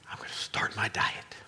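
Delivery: whispered mock resolution. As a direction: breathe it like a secret vow.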